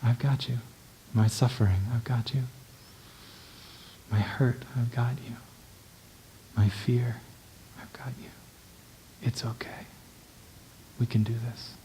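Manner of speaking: quiet tender words to oneself; self-soothing. Shown as a demonstration. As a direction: cradle your own pain gently, almost whispering. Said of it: "and I say".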